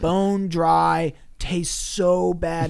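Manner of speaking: very slow, dragged words; drawn out. Real, not acted. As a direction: stretch it out in disgust. Describing bad mouth taste.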